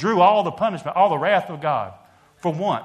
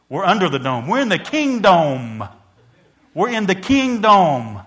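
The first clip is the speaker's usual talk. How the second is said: building preached proclamation; 4 semitones higher, 7 dB louder than usual. Real, not acted. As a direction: drive it home, building up.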